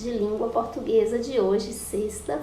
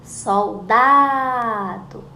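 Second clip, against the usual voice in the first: "Soldado" slow and loud, lingering on DA; "Soldado" with DA stretched long; calling out the word; 6 dB louder, much slower than usual. Shown as a demonstration. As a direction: draw out the middle of "Soldado". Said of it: "que eu mais demorei pra chamar".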